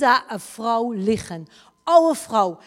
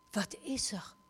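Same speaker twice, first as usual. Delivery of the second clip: hushed whispered question; 16 dB quieter than usual. Retold or imitated. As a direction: breathe the question softly. Asking the woman quietly.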